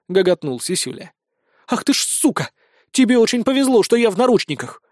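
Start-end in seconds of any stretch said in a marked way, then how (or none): 1.7-4.8 a higher-pitched, furious snarl. A narrator's reading. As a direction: spit it out at him in rage. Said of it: "рыкнула миниатюрная блондинка". (злобно рыкнуть, выше и громче)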